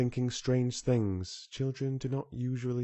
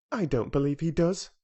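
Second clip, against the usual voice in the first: obstinate; 6 semitones higher, 4 dB louder than usual. A narrator's reading. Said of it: "said Mary quite obstinately".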